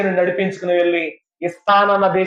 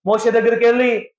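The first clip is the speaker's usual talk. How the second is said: ordinary talk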